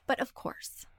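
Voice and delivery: whispered, resigned aside; exasperated whisper. Real, not acted. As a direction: breathe it out in defeat. Frustrated aside about jackhammering.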